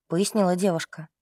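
ordinary delivery, nothing marked

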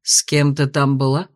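low, hard questioning; stern. A narrator's reading. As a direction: demand it sternly. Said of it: "спросил строго".

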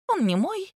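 high-pitched hasty denial; quick disavowal. A narrator's reading. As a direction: disown it at once, pitched high. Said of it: "живо открестилась от приобретения".

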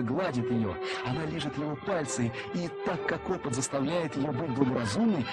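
ordinary delivery

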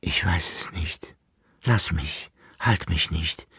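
unvoiced soft whisper pulling away. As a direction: whisper as if slipping free. Rose flüstert ihren Abschied. (geflüstert, abwehrend)

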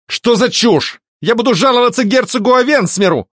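high, indignant outburst; agitated protest. A narrator's reading. Alfred threatens a complaint to the duke, agitated. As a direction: protest hotly, pitch way up. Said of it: "не успокаивался Альфред".

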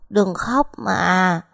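slow, drawn-out tearful plea; a little boy sobbing to his mother; little son crying, words dragged out; child crying. A narrator's reading. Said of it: "mếu máo khóc".

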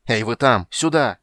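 loud, rising call to the guards; urgent loud hail; shouted call. A narrator's reading. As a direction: shout to summon men in battle. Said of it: "крикнул Карамон".

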